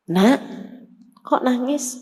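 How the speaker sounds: put-on soft, gentle question to a crying child. Prophet gently asking the crying girl, soft and kind. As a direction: ask kindly, softly.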